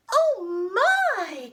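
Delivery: high, loud cry of shock; hammed-up fright, pitched high; startled tiny old woman. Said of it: "cried the tiny old woman".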